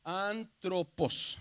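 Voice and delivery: ANTROPOS stretched syllable by syllable; slow teaching readout of antropos, each syllable held; sounded out slowly. Shown as a demonstration. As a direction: say "antropos" very slowly, in separate parts. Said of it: "se dice como una R".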